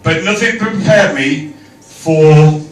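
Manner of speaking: reciting rhyming verse; poem recital. Acted. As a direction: read it like a poem.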